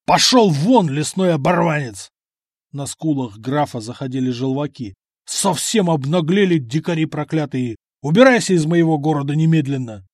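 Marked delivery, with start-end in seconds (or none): 0.0-2.1 angry dismissal. Acted. Граф гонит Само. 5.2-10.1 angry outburst. Acted. Граф в ярости.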